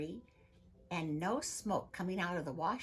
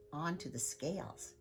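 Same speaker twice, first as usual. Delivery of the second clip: usual speaking voice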